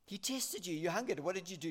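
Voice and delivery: normal talking voice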